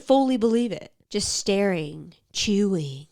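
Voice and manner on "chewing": ordinary talk, nothing marked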